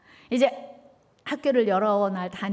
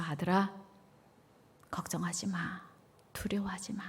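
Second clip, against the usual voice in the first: whispered, much softer comfort; hushed fatherly reassurance; tender whisper; 3 semitones lower, 10 dB quieter than usual. Retold or imitated. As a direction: soothe the frightened son in a whisper.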